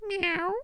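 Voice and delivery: high-pitched meow; cat meow. Acted. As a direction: mimic a cat's meow. Imitates a cat's meow.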